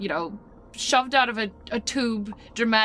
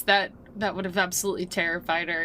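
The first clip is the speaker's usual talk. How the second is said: ordinary delivery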